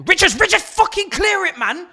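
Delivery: high, loud yell at the defender; frustrated yell. Real, not acted. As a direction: scream at him to clear it. Yelling at defender on screen.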